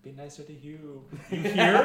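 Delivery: lightly hammed Celtic accent; shitty Celtic accent. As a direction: call out in a dodgy Celtic accent. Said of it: "in a really shitty Celtic accent".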